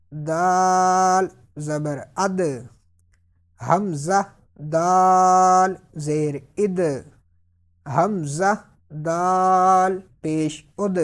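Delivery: اد ending with a bounced, echoing دال; qalqalah echo. Shown as a demonstration. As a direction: let the دال in اد bounce at the end. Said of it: "قلقلہ کی آواز نکالنی ہے".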